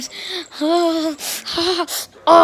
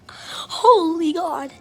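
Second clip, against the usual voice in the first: slow, drawn-out amazed words; slow awe; 4 semitones higher, much slower than usual. Real, not acted. Mobbed by puppies, slow amazed laughing. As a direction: stretch each word out in disbelief. Puppy attack.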